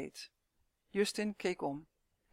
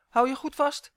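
raised, higher urgent warning; calling out; 7 semitones higher, 9 dB louder than usual. A narrator's reading. Boy carrying elf on his shoulder, calling out. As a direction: call it out firmly, loud. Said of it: "riep hij".

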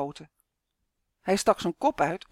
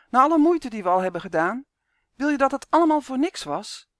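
louder, higher and fast, calling out in protest; 6 semitones higher, 6 dB louder than usual. Acted. Justin calls to Uf, louder protest. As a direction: shout it at a friend who wants to give up. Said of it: "riep Justin".

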